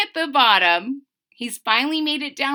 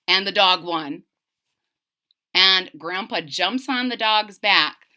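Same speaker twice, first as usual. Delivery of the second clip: usual speaking voice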